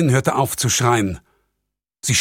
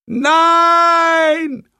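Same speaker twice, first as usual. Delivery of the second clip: high, loud, desperate scream; screaming girl; 6 dB louder than usual. A narrator's reading. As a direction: scream it in terror. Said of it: "schrie das Mädchen". (verzweifelter, schriller Schrei)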